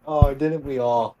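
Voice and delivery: normal talking voice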